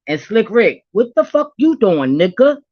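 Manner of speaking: loud teasing call. Real, not acted. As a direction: summon him like a shout across the room. Calling out [name].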